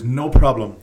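ordinary delivery

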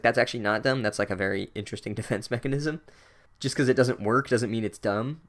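normal talking voice

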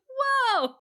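very high, mock-thrilled hailing; Caesar's delighted greeting. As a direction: greet each old pal in an excited squeal. Acting Caesar greeting surprise guests.

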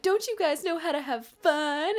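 extroverted class clown. Retold or imitated. Class clown goading his reluctant friends, hammed-up. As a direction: act out a show-off class clown. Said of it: "extroverted class clowny".

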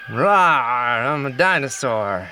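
low growly rawr, hammed-up monster; dinosaur voice. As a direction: play a silly roaring dinosaur. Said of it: "What do dinosaurs sound like?".

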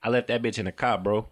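Chicago "car" as a short "kah"; Chicago accent. Acted. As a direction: drop the r in "car" like a Chicago native.

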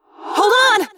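high, loud call over danger; urgent shout. A narrator's reading. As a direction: shout it to someone falling. Rescuer calls out mid-fall.

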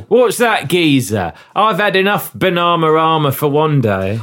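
weary, hammy Cockney sidekick; over-the-top stage Cockney; Cockney accent. Acted. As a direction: grumble in a badly faked Cockney. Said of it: "as nuanced as this Cockney accent".

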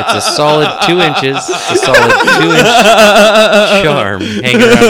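loud, high, barely holding it together; laughing hard. Real, not acted. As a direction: force the joke out through helpless laughter. Cracking up at own crude joke.

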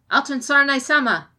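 loud, brash shout of a name; loud brash call. A narrator's reading. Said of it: "The voice was loud, brash".

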